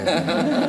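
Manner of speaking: short bright laugh; quick laugh at the joke; amused laugh. Real, not acted. Laughs at hard question.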